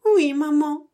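put-on high, small voice of a baby rabbit; a tiny rabbit answering sweetly; little bunny voice. A narrator's reading. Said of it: "Et Ratapon disait".

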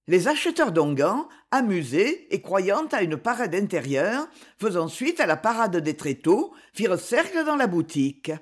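plain reading voice

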